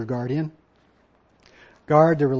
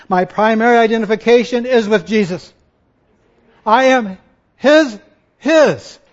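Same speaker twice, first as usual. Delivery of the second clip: loud, raised declaration of faith; impassioned preaching; 6 semitones higher, 10 dB louder than usual. Real, not acted. Pastor testifying whose he is, loud and impassioned. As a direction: proclaim it with full conviction.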